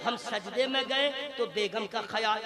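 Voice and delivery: ordinary delivery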